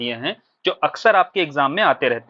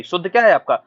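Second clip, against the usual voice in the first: ordinary talk, nothing marked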